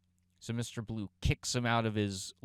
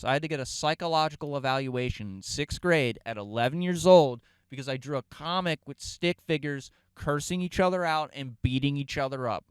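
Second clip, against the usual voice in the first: ordinary talk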